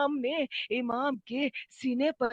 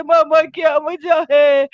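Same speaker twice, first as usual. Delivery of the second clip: loud, anguished question; tearful asking; 3 semitones higher, 12 dB louder than usual. Acted. Son asking his weeping father the reason, tearful cry. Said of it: "رو کر پوچھتے ہیں".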